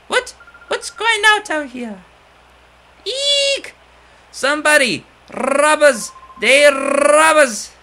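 high, loud shrieks for help; frightened old lady. Acted. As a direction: scream for help like a startled old woman.

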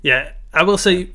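normal talking voice

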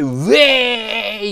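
loud cheering "ウェーイ"; party whoop. Retold or imitated. Sano cheering atop the shrine, loud whoop. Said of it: "って言ったからね".

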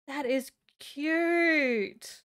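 very slow, gasping and gushing over it; a breathless, drawn-out awww tone. Real, not acted. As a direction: melt over how cute it is. Gasps at cute horse.

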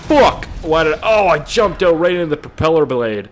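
loud, high outburst at own blunder; frustrated yell. Real, not acted. Gamer after jumping into a propeller, loud frustrated yell. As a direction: explode at your own mistake.

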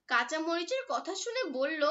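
usual speaking voice

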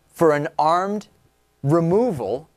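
"armed" and "removal" slow, separated by long pauses; stress on armed removal. Real, not acted. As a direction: land on "armed" and "removal" with weight. Host stresses the shocking phrase.

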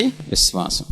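a quiet, voiceless విశ్వాసం to close the point; whispered answer. Acted. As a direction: whisper the word విశ్వాసం softly, dropping low. Answers own question, unvoiced.

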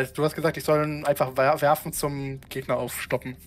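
ordinary talk

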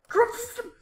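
high, loud urgent cry; panicked shout. Real, not acted. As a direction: yell the command in a panic. Panicked command in horror game.

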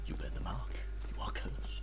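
soft, unvoiced ominous whisper; eerie mock prophecy; creepy whisper. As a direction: whisper it like a curse. Spooky game-voice imitation.

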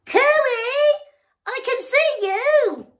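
very high, loud shouted call; frog shouting. Acted. Noisy frog shouting at the passing elephant. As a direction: yell it out cheekily from the pond. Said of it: "shouted the frog".

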